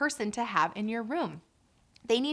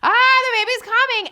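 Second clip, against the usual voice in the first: much higher and louder panicked cry; mother in labor shrieking, higher and louder; mock scream; 14 semitones higher, 12 dB louder than usual. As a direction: shriek it like labor has hit its peak. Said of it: "you're screaming bloody murder".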